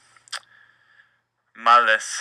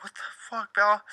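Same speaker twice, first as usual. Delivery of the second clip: much higher, much softer scold at the dog; shocked scolding; 10 semitones higher, 5 dB quieter, faster than usual. Retold or imitated. Owner scolding his dog Bella, high hushed shock. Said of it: "I'm like".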